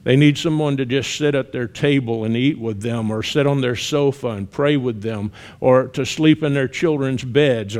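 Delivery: ordinary talk, nothing marked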